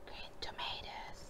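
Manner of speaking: soft unvoiced whisper. Real, not acted. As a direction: whisper it like an ASMR aside. Whispering the food name.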